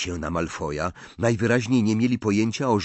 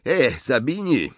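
drawling schoolboy calling over, a little higher; lightly voiced Malfoy; 6 semitones higher, 4 dB louder than usual. A narrator's reading. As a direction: call out to a classmate, lightly in character. Malfoy in a read story.

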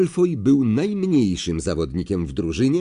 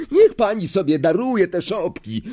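higher protesting shout; 7 semitones higher than usual. Acted. Fred to Madam Hooch, protesting shout. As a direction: protest at the ref. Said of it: "krzyknął Fred".

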